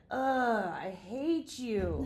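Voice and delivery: loud groan, then slow, heavy dislike; mock grumpy disgust. Young patient to cheerful morning nurse, mock grumpy disgust.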